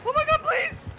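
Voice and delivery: loud, high panicked begging; desperate pleading. Real, not acted. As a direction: urge it on frantically, rising to a cry. Player desperate to escape.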